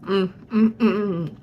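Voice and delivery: humming through a mouthful of food; mmm-ing while chewing, mouth full. Real, not acted. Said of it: "Don't wanna talk my mouth full".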